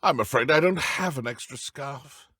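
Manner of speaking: ordinary delivery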